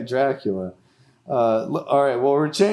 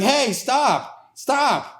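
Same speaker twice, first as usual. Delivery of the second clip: high, imitating a wife yelling stop; mock scream; 9 semitones higher, much slower than usual. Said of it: "She's screaming".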